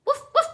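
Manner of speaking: yapping bark imitation; high yipping dog woofs; high loud woof woof. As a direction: bark like an excited pup.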